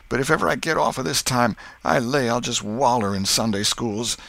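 put-on high, loud, self-reproaching boy; Tom's upset lament. Tom regrets playing hooky, loud anguished lament.

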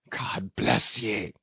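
slow, choked-up blessing; held-back, emotional slow cry; half sob, half shout. A narrator's reading. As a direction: draw it out, overcome with feeling. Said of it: "he seemed to half sob and half shout".

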